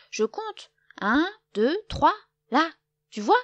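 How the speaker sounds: loud, high, insistent counting. A narrator's reading. Loup proving to Lapin he counts, loudly. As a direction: belt out the numbers to prove it.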